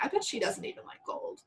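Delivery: unvoiced whispered mockery; whispered aside. Friend whispering snark about the boyfriend. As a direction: breathe it as a catty whisper. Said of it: "I'm like".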